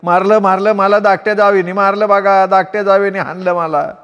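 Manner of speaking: wailing complaint. Acted. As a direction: bawl it out for the whole house. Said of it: "बोंबलायला लागली".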